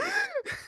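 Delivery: sudden shocked gasp, high; sharp gasp. Retold or imitated. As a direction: gasp in disbelief. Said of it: "I let out a literal gasp".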